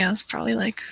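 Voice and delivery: usual speaking voice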